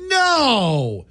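high, crying wail of denial; mock anguished no. Dad retelling his reaction to the fake news, high crying wail. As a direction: wail it like heartbreak, half-played.

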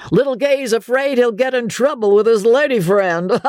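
high-pitched, jeering holler; teasing bellow. A narrator's reading. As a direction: bellow the taunt for everyone to hear. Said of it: "bellowed the teaser".

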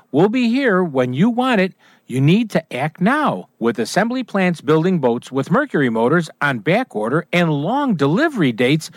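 upbeat, punchy commercial voice; radio ad read. Acted. Announcer reading a boat dealer ad to listeners, upbeat ad read. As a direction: sell it like a local radio spot.

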